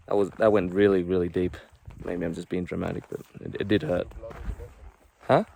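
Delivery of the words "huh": normal talking voice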